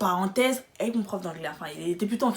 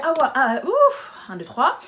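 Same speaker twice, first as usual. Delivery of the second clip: mocking laugh; 4 semitones higher, 5 dB louder, slower than usual. Mocking the supervisor's reaction.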